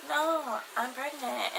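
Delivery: high-pitched, softening mock whimper; emotional retold confession. Telling crying best friend the news.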